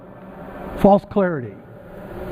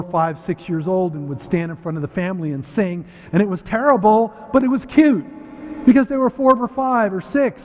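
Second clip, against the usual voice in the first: ordinary talk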